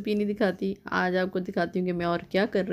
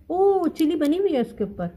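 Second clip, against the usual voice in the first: sudden realisation; 7 semitones higher than usual. Real, not acted. Finds the chili drawn on it.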